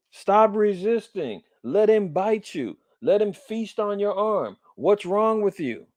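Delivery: lightly voiced cop taunting the kid; mocking cop. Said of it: "And the damn cop says".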